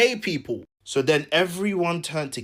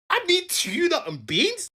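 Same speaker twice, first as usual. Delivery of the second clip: high-pitched mock disbelief; hammed-up incredulous question, pitched high; 13 semitones higher than usual. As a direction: squeal the question as if appalled. Acted disbelief at the food.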